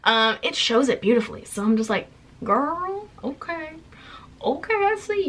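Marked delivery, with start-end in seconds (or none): 2.4-5.3 sassy self-hype. Retold or imitated. Admiring herself in the top.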